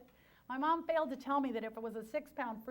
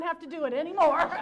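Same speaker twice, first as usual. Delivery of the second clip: louder, laughter creeping in; laughing; 4 semitones higher, 10 dB louder than usual. Real, not acted. Speaker tells the audience about not baking anymore, laughing. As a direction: start laughing as you get to the punchline. Laughing at her own relief.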